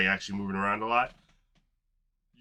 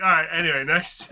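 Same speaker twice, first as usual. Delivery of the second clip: dismissive impression; 7 semitones higher, 7 dB louder than usual. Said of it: "the way he says".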